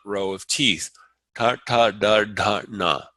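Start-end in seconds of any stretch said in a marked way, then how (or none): 1.3-3.0 Ta, ta, da, da, na spoken as clean model syllables; retroflex drill. Shown as a demonstration. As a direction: sound out each retroflex for the class. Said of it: "tongue going against the alveolar ridge".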